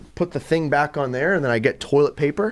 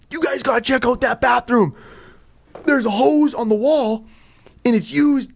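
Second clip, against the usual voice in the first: high-pitched eager pitch to teammates; excited retelling; 9 semitones higher than usual. Retold or imitated. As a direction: sell it like a great discovery. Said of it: "and I will go".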